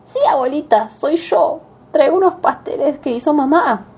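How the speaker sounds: put-on wolf pitching up to sound like a girl; wolf faking girl voice. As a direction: fake a sweet little girl voice. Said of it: "hacerse pasar por la niña".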